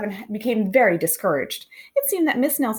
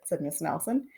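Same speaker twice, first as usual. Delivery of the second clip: usual speaking voice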